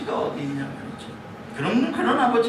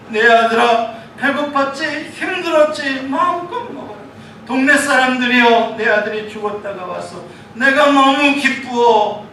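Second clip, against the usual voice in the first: joyful father; 5 semitones higher, 6 dB louder than usual. Acted. 아버지가 돌아온 아들 맞이함.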